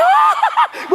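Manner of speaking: high, loud laughing shriek; mock swooning squeal. Women reacting to the handsome pastor, squealing. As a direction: squeal like a thrilled admirer.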